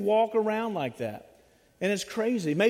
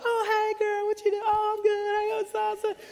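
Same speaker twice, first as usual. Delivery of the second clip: much higher, sugary fake-friendly voice; fake friendly girl; 11 semitones higher than usual. Treacherous friend greeting a teen, high and sugary. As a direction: gush like a two-faced friend. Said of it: "they're like".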